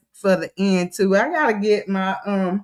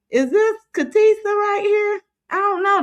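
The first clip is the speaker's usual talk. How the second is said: much higher, startled double take; surprised recognition; 10 semitones higher than usual. Real, not acted. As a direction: pitch jumps up in surprise at a familiar face. Spotting a familiar viewer picture.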